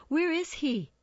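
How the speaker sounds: high, irritable demand. A narrator's reading. Mercutio waiting for a late Romeo, irritable. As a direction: demand it irritably, pitch jumping up. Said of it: "he demanded irritably".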